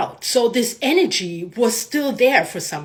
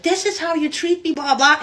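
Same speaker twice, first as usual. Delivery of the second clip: retold angry screaming; 6 semitones higher than usual. Screaming at the universe in her mind, mock outrage. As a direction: complain in a high, contained mock scream. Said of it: "screaming at the universe in my mind".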